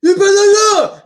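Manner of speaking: high, loud mock threat; Stallone impression. Warning the viewers in a loud mock Stallone voice. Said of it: "my Sly Stallone impression".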